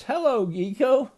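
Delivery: high, lightly voiced gator hello; friendly greeting. A narrator's reading. Gator says hello to the gecko, high lightly acted greeting.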